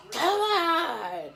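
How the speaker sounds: high mock yell of a name; imitated scream. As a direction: call it out high, half-screamed. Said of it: "would scream".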